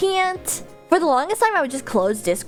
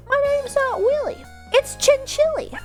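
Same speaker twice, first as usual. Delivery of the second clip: squeaky high cartoon voice; cute anime streamer; 13 semitones higher than usual. Cute character line.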